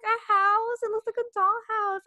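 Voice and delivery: high, gushing mock delight; delighted squeal. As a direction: squeal it like a kid seeing a toy. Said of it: "I was like".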